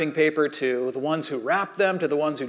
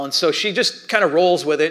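normal talking voice

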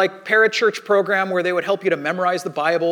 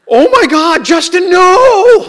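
high, loud panicked yell of a shocked leader; loudest yell; 11 semitones higher, 13 dB louder, much slower than usual. Retold or imitated. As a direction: yell it as if you caught kids doing something awful. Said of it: "the most loudest yell".

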